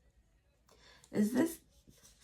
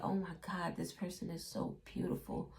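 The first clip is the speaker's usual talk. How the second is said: smitten whisper; 2 semitones lower, 9 dB quieter than usual. Retold or imitated. Smitten by a stranger.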